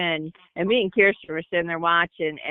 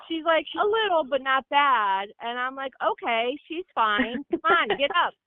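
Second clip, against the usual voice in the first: mock sweet mom; 6 semitones higher, 3 dB quieter than usual. Mom's fake concern, soft high.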